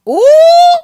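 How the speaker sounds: loud, high delighted ooh; a squealed ooh at juicy gossip. Real, not acted. A loud high ooh at the spicy post.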